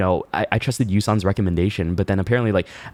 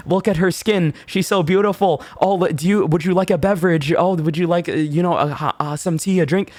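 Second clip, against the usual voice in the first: fawning staff; 9 semitones higher than usual. Retold or imitated. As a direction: overdo the sucking-up to the pretty customer. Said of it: "I was like".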